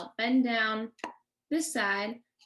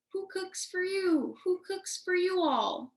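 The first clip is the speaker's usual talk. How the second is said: barred owl call; 7 semitones higher than usual. Shown as a demonstration. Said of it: "this owl says".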